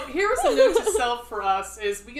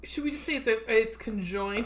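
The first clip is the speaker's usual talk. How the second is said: ordinary delivery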